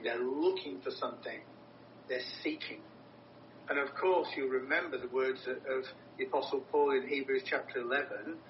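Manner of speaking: usual speaking voice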